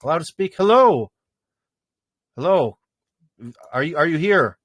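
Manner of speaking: ordinary talk, nothing marked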